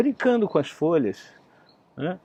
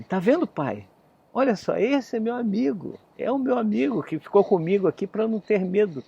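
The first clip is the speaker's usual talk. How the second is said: voz de criança; 7 semitones higher than usual. Acted. Menino mostra o amigo ao pai.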